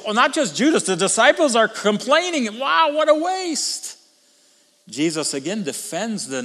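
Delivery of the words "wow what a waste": mocking complaint. Disciples complaining about the waste.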